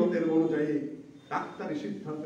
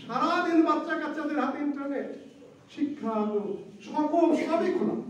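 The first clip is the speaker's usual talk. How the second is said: raised, laughing reproach; rebuking; 10 semitones higher, 3 dB louder than usual. Acted. Preacher scolding listeners for wasting time, reproachful. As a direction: chide them, half laughing.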